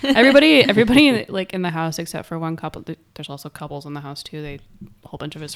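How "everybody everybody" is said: loud, stretched emphasis; loud emphatic. Real, not acted. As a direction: belt it out for emphasis. Excited emphasis in story.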